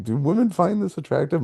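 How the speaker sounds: high-pitched baffled question; incredulous. Retold or imitated. Husband asking wife about the movie's lead, incredulous. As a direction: pitch it way up in disbelief.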